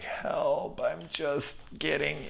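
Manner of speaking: high, soft mock-victim voice; imitated plea for help. Voicing people lined up at the hospital, feebly pleading.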